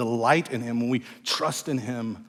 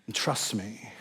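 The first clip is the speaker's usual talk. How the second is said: tender reassurance; 3 semitones lower, 9 dB quieter than usual. Acted. Pastor voicing God, softly reassuring. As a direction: drop soft and gentle. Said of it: "It's God's way of saying".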